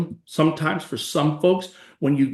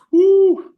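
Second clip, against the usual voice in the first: whoo exhale; 10 dB louder than usual. Real, not acted. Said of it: "tough years exclamation".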